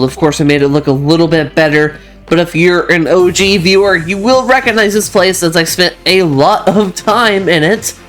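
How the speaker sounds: ordinary delivery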